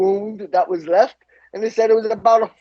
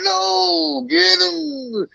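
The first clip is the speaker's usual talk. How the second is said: much higher, strained cry; desperate plea; 11 semitones higher, much slower than usual. Retold or imitated. Macho Man restrained by cops, crying out to catch the attacker.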